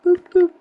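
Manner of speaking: high, loud boop boop noises; squeaky boop sounds; playful boops. Real, not acted. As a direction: tap it out like a cartoon sound.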